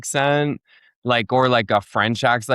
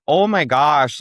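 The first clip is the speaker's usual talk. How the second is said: higher Fargo accent demo; 4 semitones higher, 4 dB louder, slower than usual. Shown as a demonstration. Comedian showing podcast host how locals talk, Fargo accent. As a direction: put on the clichéd Fargo accent. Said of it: "there's that sort of cliched accent".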